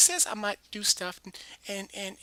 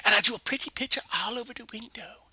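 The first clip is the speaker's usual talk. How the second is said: ordinary talk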